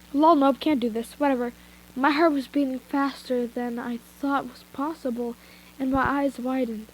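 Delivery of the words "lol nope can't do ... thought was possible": plain reading voice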